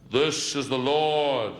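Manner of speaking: very slow, booming mock God voice. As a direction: drag it out like a voice from heaven. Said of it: "one of them shouts down".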